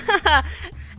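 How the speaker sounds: short high laugh; flustered giggle; embarrassed laugh. Real, not acted. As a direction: laugh at your own awkwardness. Embarrassed laugh after phone call.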